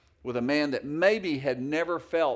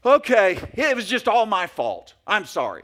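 loud, higher, curt apology that isn't meant; grudging fake apology; 5 semitones higher, 8 dB louder than usual. Still-mad partner forcing out a fake sorry. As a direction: snap out a sorry you don't mean. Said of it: "that sounds so sincere".

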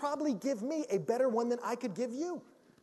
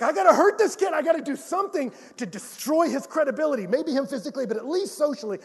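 mock rage; 6 semitones higher, 7 dB louder than usual. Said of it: "was like".

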